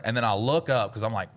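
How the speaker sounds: usual speaking voice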